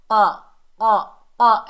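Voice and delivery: क़ा short and loud from the tongue root; deep qaf. Shown as a demonstration. Teacher demonstrates qaf, full-mouthed क़ा. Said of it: "ऐसे करके".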